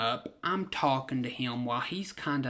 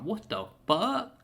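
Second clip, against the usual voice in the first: surprised; 6 semitones higher than usual. Retold or imitated. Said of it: "I was like".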